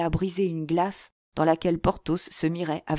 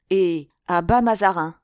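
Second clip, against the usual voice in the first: loud reported slogan, pitch near usual; imitating a street chant, louder but controlled; quoted protest cry; 8 dB louder than usual. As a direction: sound out the crowd's slogan boldly. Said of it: "ce qu'on a voulu nous faire crier encore".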